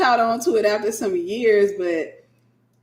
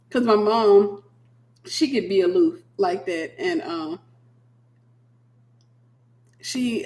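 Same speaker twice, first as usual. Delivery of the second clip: ordinary talk, nothing marked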